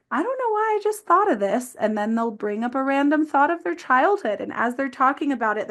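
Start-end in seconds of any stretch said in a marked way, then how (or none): 0.1-1.7 higher-pitched, puzzled client voice; imitated client, pitched well up; voicing a client. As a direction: quote a client in a high, lifted voice. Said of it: "comes with someone saying".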